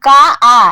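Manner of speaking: কা আ with the আ held out loud; drawn vowel. Shown as a demonstration. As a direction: stretch কা into আ to show the open vowel. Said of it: "এর শেষে স্বরধ্বনি আছে আ". (কা-র আ টেনে বলা)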